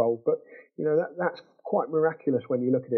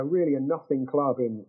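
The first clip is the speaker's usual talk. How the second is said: usual speaking voice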